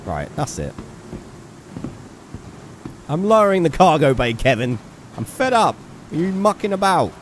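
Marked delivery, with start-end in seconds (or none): 3.1-7.1 loud, higher exasperated telling-off; real mock fury at a companion; fed-up outburst. Real, not acted. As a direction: scold the useless crewmate at full volume. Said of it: "I get angry".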